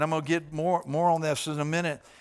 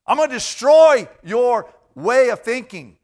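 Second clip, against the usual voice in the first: louder, higher preached declaration; preaching at full force; 6 semitones higher, 12 dB louder than usual. Acted. Preacher proclaiming Jesus's words to the congregation, booming. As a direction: thunder it from the pulpit.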